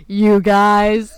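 loud mocking echo. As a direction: bellow the words back in mockery. Mimics friend's "you guys.

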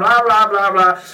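loud imitation baby babble; baby talk. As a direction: babble insistently like a toddler. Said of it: "getting louder and louder and louder".

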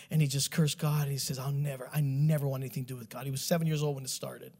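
low, soft grave narration; hushed, somber testimony; solemn storytelling. A narrator's reading. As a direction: tell a painful history quietly. Tells sad life story, hushed.

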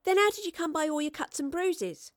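put-on high-pitched young girl's voice; curious young girl. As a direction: pipe up high as a nosy girl. Young girl Astrid asks.

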